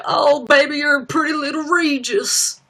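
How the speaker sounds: high, hammed-up doting country dad. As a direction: imitate a gushing celebrity father. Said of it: "whoever's playing [name], like".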